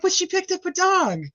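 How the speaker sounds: very high whining protest; mock whiny plea. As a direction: whine like a kid defending a friend. Her own whiny retort.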